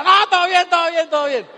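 todo bien entre risas, agudo y fuerte; riendo. Retold or imitated. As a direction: tranquilizar riéndose, mucho más alto y fuerte. Tranquiliza al paramédico riendo. (tranquilizando al paramédico entre risas, en voz alta)

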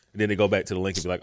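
ordinary talk, nothing marked

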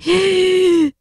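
high, sharp mock gasp; shocked gasp. Student retelling the shock at the retort, sharp gasp.

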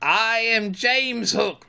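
slow, high, grand pirate voice; pompous drawn-out declaration; Hook. A narrator's reading. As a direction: announce yourself as the captain. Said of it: "answered in Hook's voice".

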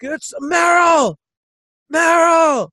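high, acted yelling for a friend; urgent high-pitched calling out; screaming. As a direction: scream the name to get her attention. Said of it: "I'm just screaming".

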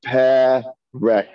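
PEREK with two open short eh vowels. Shown as a demonstration. Teacher correcting students on the segol, short eh vowels in PEREK. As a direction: say PEREK slowly as peh-rek, short eh twice. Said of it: "The eh, eh. So go".

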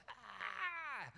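mock child's scream. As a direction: imitate a screaming child at held-back volume. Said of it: "she just starts screaming".

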